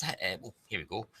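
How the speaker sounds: usual speaking voice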